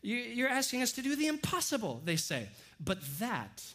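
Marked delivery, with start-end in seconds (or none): 0.0-2.0 high, strained-sounding objection; acted-out exasperated plea, pitch held high; offended protest. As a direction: object as if asked too much. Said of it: "the disciples get a little offended".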